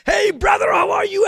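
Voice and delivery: high, very loud, exuberant hello; effusive shouted greeting. Retold or imitated. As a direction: hail him like a long-lost brother. Acts out friend's effusive greeting.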